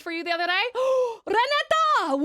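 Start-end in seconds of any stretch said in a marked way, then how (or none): 0.7-1.2 excited gasp. Real, not acted. 1.3-2.2 squealing her name; high excited shout; excited call. Real, not acted.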